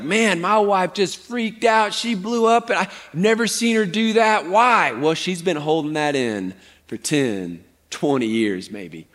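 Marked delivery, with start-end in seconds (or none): 0.0-4.9 raised pitch, puzzled complaint; bewildered husband. Acted. Preacher imitating a guy whose wife blew up, bewildered. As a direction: act a guy baffled by the blow-up. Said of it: "the guy that comes home and he's like".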